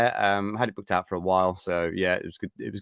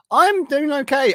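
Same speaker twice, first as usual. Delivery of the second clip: slow, much higher and louder sing-song; 7 dB louder, slower than usual. Real, not acted. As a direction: draw it out high and playful. Answering chat, stretched.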